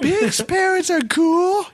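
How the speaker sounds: high, stretched-out whine; hammed-up slow, high sulk; whiny mock Luke. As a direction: drag it out like an envious kid. Acts Luke whining about friend.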